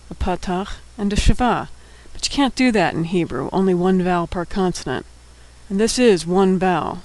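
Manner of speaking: ordinary talk, nothing marked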